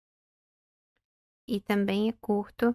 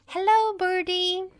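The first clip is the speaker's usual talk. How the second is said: calling out; 9 semitones higher, 7 dB louder, slower than usual. Acted. Character calls out to a bird.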